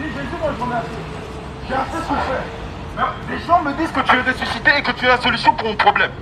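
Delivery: normal talking voice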